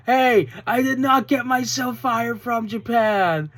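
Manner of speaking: put-on high, loud, drawn-out accented promo voice; Tekla. Fan mimics wrestler denying she was fired, indignant impression. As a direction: protest slowly and loudly in a hammed-up imitation. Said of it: "and then Tekla goes".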